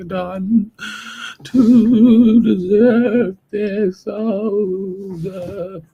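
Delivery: high, slow, drawn-out wailing lament; tragic heroine sorrow. Pretend. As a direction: wail to the heavens like a tragic heroine. Mocks people lamenting to heavens.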